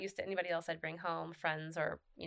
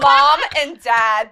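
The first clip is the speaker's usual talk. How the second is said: slow, loud and high; drawn out; 7 semitones higher, 20 dB louder, much slower than usual. Pretend. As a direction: stretch it out, sing-song. Imagined tattoo text, drawn out.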